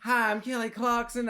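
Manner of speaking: loud, high Southern twang. Acted. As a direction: announce yourself in a hammed-up Texas accent. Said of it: "she has, like, a southern accent".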